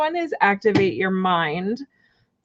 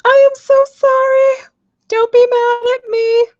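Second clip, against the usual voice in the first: much higher, louder and slow pleading apology; squealed apology; 6 dB louder than usual. Real, not acted. Reader squealing sorry to the viewer over the card. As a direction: beg forgiveness in a squeaky cry.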